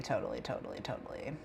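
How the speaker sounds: fading to a whisper. Acted.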